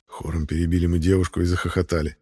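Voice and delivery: ordinary delivery, nothing marked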